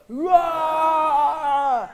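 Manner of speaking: loud roaring lion. Acted. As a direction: roar like a hungry lion. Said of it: "Let's pretend to be like a lion".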